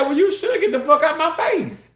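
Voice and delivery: his blunt retort. Retold or imitated. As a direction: throw it right back at him. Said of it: "I said it just like that".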